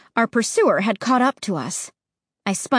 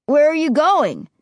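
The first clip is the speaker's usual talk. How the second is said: louder, higher call after them; 6 semitones higher, 5 dB louder than usual. Acted. Ray calling after the boys in the dark, loud and higher. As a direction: call out to friends moving away. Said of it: "Ray called".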